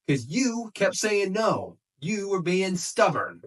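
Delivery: slow, high, drawn-out accusing tone; acted accusation. Wrestler blaming his old partner, imitated accusation. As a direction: stretch out the blame, imitating the wrestler.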